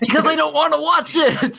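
high, loud complaint; whining protest. Real, not acted. Arguing with co-hosts about watching Rip Girls, whining protest. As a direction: wail it in exasperated protest.

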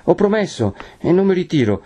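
high, anxious assurance; renewed fright. A narrator's reading. Lucia hastens to confirm her promise, frightened. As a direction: insist in alarm. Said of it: "cominciando a rispaventarsi".

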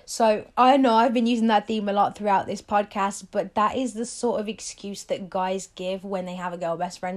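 usual speaking voice

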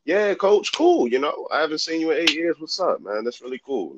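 high-pitched retold agreeableness; acted polite reply. Former player answering his old coach, high acted reply. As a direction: play along, pitched well above normal.

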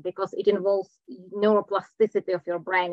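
ordinary talk, nothing marked

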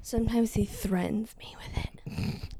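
whispered aside. Real, not acted. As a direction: say it under your breath.

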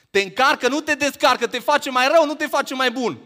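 loud, high, punching each short phrase; forceful preaching. Real, not acted. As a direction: hammer the point home. Preacher hammers the point.

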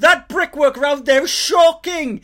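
high, loud Welsh snagging inspector; Welsh builder impression. Impression of the Welsh snagging guy tutting at a new build. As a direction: pick apart a shoddy build in Welsh. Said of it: "You're very good at an impression of him".

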